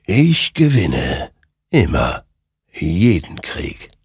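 slow, drawn-out ominous pronouncement; stately, menacing spectral voice; otherworldly creature, triumphant. A narrator's reading. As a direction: linger on each word like a dark victor.

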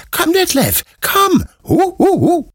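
excited puppy. A narrator's reading. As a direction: yip it out, too thrilled to stand still. Excited puppy calls owner.

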